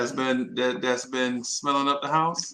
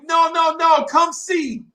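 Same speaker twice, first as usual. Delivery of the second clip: high, loud call with drawn-out ending; calling back; 12 semitones higher, 5 dB louder, slower than usual. Retold or imitated. Girls waving back from afar, high shouted invitation. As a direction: imitate girls hollering from far off.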